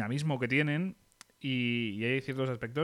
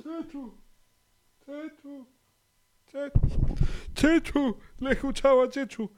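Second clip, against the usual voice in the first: wailing mother voice; 13 semitones higher, 5 dB louder, slower than usual. Pretend.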